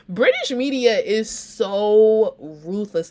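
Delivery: drawn-out mock dismay; dramatic exasperation. Real, not acted. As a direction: lean into it like a theatrical sigh. Reacting to harsh British press.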